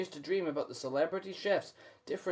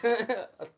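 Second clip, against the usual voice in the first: short laugh; 6 semitones higher than usual. Real, not acted. Laughing at chat.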